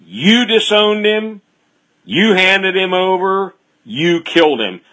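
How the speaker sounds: preacher voicing a bold apostle; bold accusation. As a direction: hurl the blame straight at them. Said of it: "very bold in their preaching".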